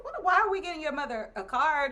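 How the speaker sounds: a high, surprised "Oh, what" that settles back down; confused surprise. Retold or imitated. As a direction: act baffled at getting the card. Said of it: "I'm like".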